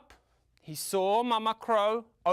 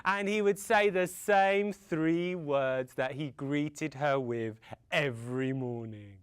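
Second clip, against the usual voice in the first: ordinary delivery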